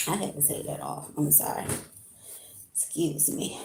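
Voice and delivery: soft, breathy whispered apology; whispered aside. Real, not acted. Streamer whispering an apology while pulling off her sweater. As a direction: drop to a whisper as if the words slipped out off-mic.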